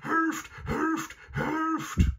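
very high, loud howl for help; shrill yelping alarm from the hound; howling dog. A narrator's reading. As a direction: cry out like a panicked talking dog. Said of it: "heulte Garm".

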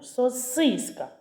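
«Сосиска» stressed on и, so-SYS-ka; stress on middle syllable. Shown as a demonstration. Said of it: "голосний «и». Спробуй стати головним". (наголос на «и» в слові «Сосиска»)

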